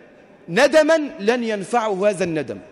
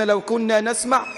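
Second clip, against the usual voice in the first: ordinary talk, nothing marked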